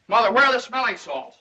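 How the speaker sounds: high, loud, panicky question; panicked. Acted. Son to his fainting mother, panicked. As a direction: call out urgently for help.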